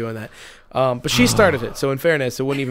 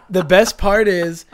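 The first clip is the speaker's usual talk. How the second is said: normal talking voice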